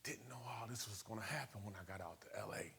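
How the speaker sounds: hushed unvoiced whisper. Real, not acted. Telling the audience what was coming, whispered. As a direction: breathe it out as a whisper. Reflective aside.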